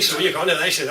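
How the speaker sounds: ordinary delivery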